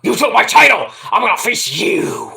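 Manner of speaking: mock-furious challenge to a rival; Ronda's angry challenge. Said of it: "Or Ronda's like".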